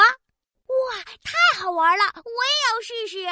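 put-on loud, high delighted kid; thrilled and eager to try; excited. Child delighted by skipping stones.

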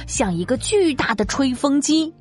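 ordinary talk

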